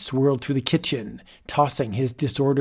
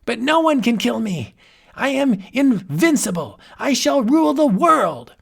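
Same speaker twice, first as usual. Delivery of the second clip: megalomaniac ranting; 9 semitones higher than usual. Acted. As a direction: rant like a madman crowned. Mad wizard exults.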